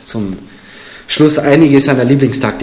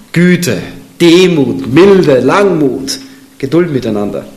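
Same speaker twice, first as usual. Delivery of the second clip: solemn, raised reading of a Bible verse; scripture reading; 5 semitones higher, 3 dB louder than usual. Acted. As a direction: proclaim the verse to the congregation. Reads scripture aloud.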